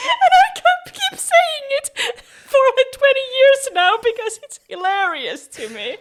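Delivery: high squealing laugh through the words; can barely get it out for laughing. Real, not acted. Telling her co-host about the textbook, laughing hard.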